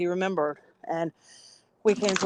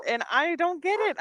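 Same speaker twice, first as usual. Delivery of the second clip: ordinary delivery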